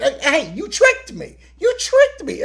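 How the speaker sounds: imitating her squeaky high protest; high mock-offended squeal; mock high indignant. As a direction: pitch it way up, playfully outraged. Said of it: "she's like".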